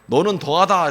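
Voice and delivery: retort. Retold or imitated. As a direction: fire it right back at her.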